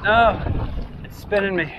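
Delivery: high strained groans mid effort; straining. Real, not acted. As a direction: push the words out while paddling. Paddling into strong wind.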